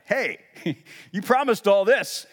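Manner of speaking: a high, loud, half-laughing protest; mock-outraged "you promised"; indignant complaint. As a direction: call God out on a broken promise. People complaining to God.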